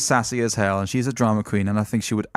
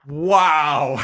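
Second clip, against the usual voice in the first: normal talking voice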